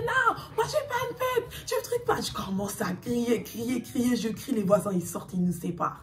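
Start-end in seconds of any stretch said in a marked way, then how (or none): 0.0-2.2 high shrieking reply; retold screaming. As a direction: scream back at him in the fight. Screaming at ex in fight. (crie d'une voix très aiguë)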